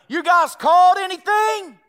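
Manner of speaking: loud and slow, carried over water; calling out, slow. Retold or imitated. Jesus calls to fishermen out on the lake, slow loud call. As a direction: stretch it out to reach the boat.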